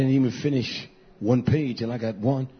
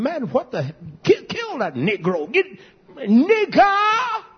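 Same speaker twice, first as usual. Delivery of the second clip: rising to a high furious shout; angry crowd voice; 11 semitones higher, slower than usual. Retold or imitated. Preacher voicing an angry crowd, high furious shout. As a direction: yell it out like an enraged mob.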